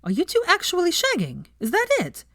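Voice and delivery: put-on high, playful girlfriend voice teasing; teasing Veronica. Veronica teasing Hermione across the table, playful high voice. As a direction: poke fun at her friend.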